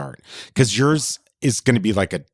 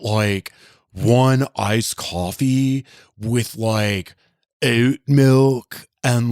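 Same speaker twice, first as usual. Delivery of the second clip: slow, drawled Southern California coffee order; lazy SoCal bro ordering coffee; 3 semitones higher, much slower than usual. Retold or imitated. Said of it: "so I can't do the, like".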